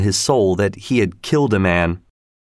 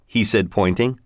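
ordinary delivery, nothing marked

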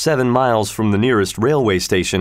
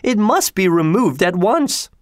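higher, urgent lady's demand; shocked demand; 9 semitones higher than usual. A narrator's reading. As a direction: insist it go at once, mildly alarmed. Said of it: "cried Missus Otis".

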